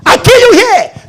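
high, loud threat; hammed-up killer shout; mock threat. Believer mocked for threatening people, angry shout. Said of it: "you think".

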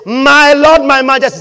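calling out loud and high to the queen; loud proclamation. Retold or imitated. Stephen stands and hails the new queen, loud and high. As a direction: proclaim it like announcing royalty. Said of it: "Stephen still stand up and say".

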